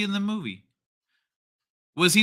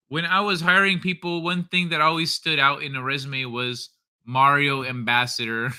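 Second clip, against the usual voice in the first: usual speaking voice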